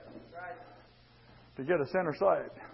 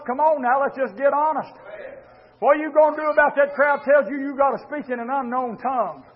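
ordinary talk

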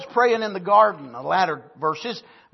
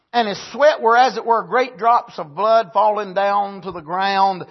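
raised, declaiming Bible reading; scripture reading; 3 semitones higher, 6 dB louder than usual. Acted. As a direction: read the verse out with preacher's lift. Reading scripture aloud in sermon.

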